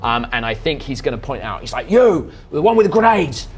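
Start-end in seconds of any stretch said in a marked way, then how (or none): 1.9-3.5 commanding yell, higher and louder; shouted order. Acted. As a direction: boss barking at the grenadier. Leader orders grenade thrower.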